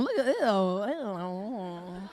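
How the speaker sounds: slow, low, drawn-out ew; mock disgust. Comic sneering at couples in the audience, slow mock disgust. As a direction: stretch out the ew in disgust.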